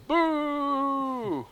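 long, high mock boo; crowd boo. Crowd rejecting the candidate, long high mock boo. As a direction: draw out a high-pitched boo. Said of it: "And they go".

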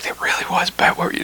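hushed whisper of the crew; whispered quote. Retold or imitated. Crew telling the comedian afterwards, in a whisper. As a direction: breathe it out as a secret verdict.

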